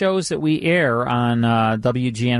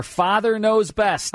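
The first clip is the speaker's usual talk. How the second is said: slow, high show announcement; announcer; 8 semitones higher, slower than usual. Acted. Host announcing the next hour's show, slow and high. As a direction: billboard the title like an old radio host.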